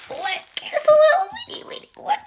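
high whispery squeal over something tiny; squeaky tiny voice. Real, not acted. Tiny sketchbook, delighted.

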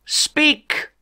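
a high, barked one-word order; commanding shout. Acted. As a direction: bark it like a tyrant on his throne. Overlord commands the tax inspectors.

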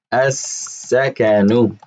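as-sakanu drawn out slowly, each syllable clear; Arabic word said slowly. Shown as a demonstration. Teacher pronouncing as-sakanu slowly for the brothers. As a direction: spell out as-sakanu slowly for students. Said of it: "The word as-sakanu".

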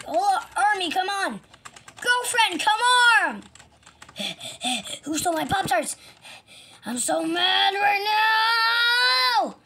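high, loud panicked cartoon voice; frantic game character. Victim rallies his army.

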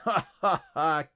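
staged evil laugh; villain's cackle. A narrator's reading. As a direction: cackle in gloating triumph. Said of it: "cackled the frog".

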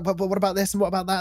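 fast, eager quoted questions; rapid questioning. Retold or imitated. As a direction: rattle the questions off quickly. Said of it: "They're asking all these questions".

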